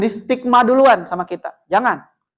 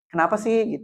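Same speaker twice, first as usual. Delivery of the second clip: soft, calm, inviting question; gentle empathy; 6 dB quieter than usual. Shown as a demonstration. Teacher calmly asking a student what happened, gentle and soft. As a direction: ask gently so the child opens up. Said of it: "kita harus dengan empati".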